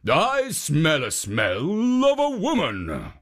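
slow, lightly voiced ogre; a hint of an ogre sniffing her out. A narrator's reading. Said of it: "sniffing the air again".

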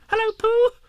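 very high, loud squeak; squeaky Roo. A narrator's reading. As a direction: squeak it out like an excited little kangaroo. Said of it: "he squeaked".

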